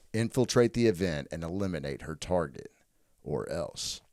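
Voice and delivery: low, measured trailer-style read; pitch narration. Acted. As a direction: read it like a movie synopsis. Reads movie pitch synopsis.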